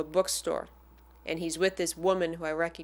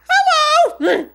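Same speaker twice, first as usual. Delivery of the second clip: a tiny high squeaked hello, much louder; 15 dB louder than usual. Retold or imitated. Fan meets her idol and squeaks hello. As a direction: squeak it out starstruck. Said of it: "and I squeak out".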